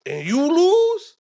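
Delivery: high, drawn-out mock dad warning, breaking into laughter; imitating a stern dad. Dad warns his kid about losing a school fight, mock threat.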